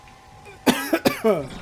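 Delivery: loud coughing fit after a gasp; choking cough. Real, not acted. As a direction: choke on your breath and cough hard. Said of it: "Almost choked on my gasp".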